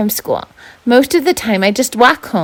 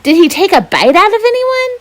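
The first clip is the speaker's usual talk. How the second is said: much higher and louder, thrilled; eager excitement; 11 semitones higher, 4 dB louder than usual. Acted. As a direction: ask it like a kid who can't wait for gory news. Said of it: "Mac's face lights up".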